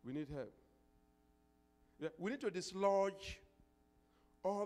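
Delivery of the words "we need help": usual speaking voice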